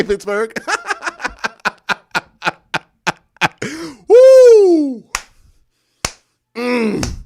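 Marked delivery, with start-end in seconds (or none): 0.6-2.2 a real high cackle of glee; high gleeful laugh. Real, not acted. Streamer laughing at losing rival fans, gleeful high laugh. 4.1-5.0 a real, high, loud "Woo!"; loud victory whoop. Real, not acted. Fan celebrating his team's playoff win, loud high whoop.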